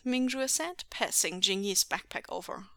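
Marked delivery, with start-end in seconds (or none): none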